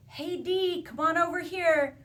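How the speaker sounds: somebody calling out. Acted. Storyteller voices someone calling the boy over, calling out. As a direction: sing out a friendly come-over call. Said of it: "If somebody said".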